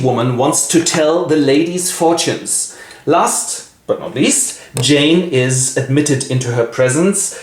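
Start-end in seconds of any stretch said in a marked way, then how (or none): none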